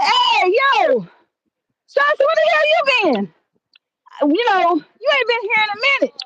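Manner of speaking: high-pitched, playful retold greeting; hailing a coworker. Man greeting a coworker not seen lately, surprised hail. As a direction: call to a long-missed workmate, pitched well up.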